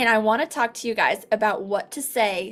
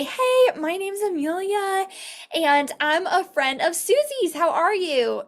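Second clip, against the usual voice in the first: higher, bubbly scripted hello; cheery phone greeting; 7 semitones higher than usual. Shown as a demonstration. Consultant greeting a referral on the phone, upbeat. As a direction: sell the friendly opener, pitched up. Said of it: "you just say".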